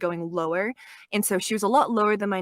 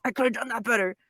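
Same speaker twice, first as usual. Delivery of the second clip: a touch of her flat monotone; Hachimitsu voice; faster than usual. Shown as a demonstration. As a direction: say it in the character voice. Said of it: "this one was my strongest".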